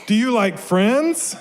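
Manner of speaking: high, slow, rising question; eager mouse. A narrator's reading. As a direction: ask it hopefully as the little mouse. Mouse eagerly asks squirrel about friends.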